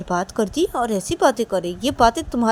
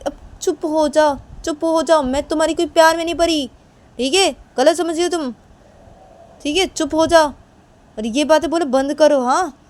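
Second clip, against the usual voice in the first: high, loud telling-off; angry scolding; 6 semitones higher, 7 dB louder than usual. Acted. Ordering the other to stop talking, angry scolding. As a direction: shut them up forcefully, high and loud. Acted quarrel, ordering silence.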